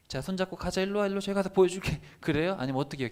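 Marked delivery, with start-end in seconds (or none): 0.1-2.0 high, sweet coaxing voice; playful mock coaxing; coaxing invitation. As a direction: lure him along gently, pitched high. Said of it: "기분이 안 좋을 때 사람들을 어떻게 데리고 다녀요".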